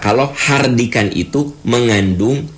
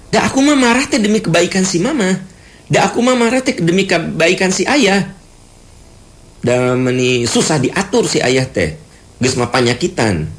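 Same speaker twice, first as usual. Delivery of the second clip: higher, quick self-justifying grumble; defensive complaint; 10 semitones higher than usual. Acted. Child justifying scolding a stubborn sick father, defensive grumble. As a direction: excuse the anger, a little aggrieved.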